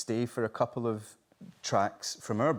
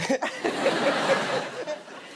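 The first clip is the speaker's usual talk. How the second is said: high unvoiced burst of laughter; high breathy laugh; 4 dB louder than usual. Real, not acted. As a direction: let out a wheezy laugh. Laughs at toilet story.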